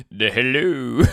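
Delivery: mock podcast greeting. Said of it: "That's gonna do it?".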